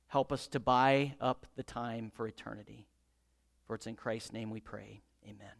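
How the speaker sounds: soft, low closing prayer. Acted. Preacher praying before the congregation, hushed and reverent. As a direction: pray quietly and reverently to the Father.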